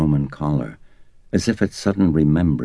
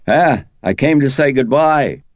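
called across the street, louder and higher; calling out; 11 semitones higher, 7 dB louder than usual. A narrator's reading. Detective hurrying over calls goodbye across the street, calling out loud. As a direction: hail him from a distance.

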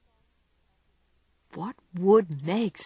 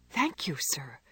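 softer, breathless whispered thank you; 4 semitones lower, 6 dB quieter, slower than usual. A narrator's reading. Jo thanks the old gentleman in a hushed whisper.

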